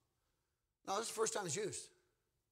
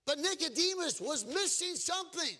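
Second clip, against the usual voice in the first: high, raised preacher's voice; full-force preaching; 10 semitones higher, 5 dB louder than usual. Acted. Preacher tells the congregation what Nicodemus lacked, full-force preaching.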